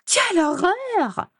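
drawn-out, squealed mock horror; high-pitched mocking imitation. A narrator's reading. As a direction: mimic her slowly in a very high voice. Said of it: "limita-t-elle avec une voix aiguë". (imitation moqueuse, voix aiguë et traînante)

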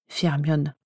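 plain reading voice

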